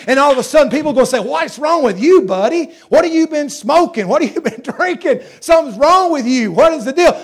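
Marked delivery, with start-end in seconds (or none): 1.2-7.2 mocking onlookers. Mocking people questioning him.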